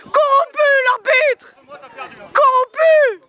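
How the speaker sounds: outraged yell. Real, not acted. Fan yelling at referee.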